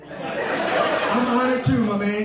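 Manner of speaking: laughing. Real, not acted. Laughing reply at ceremony.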